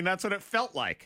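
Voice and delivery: ordinary talk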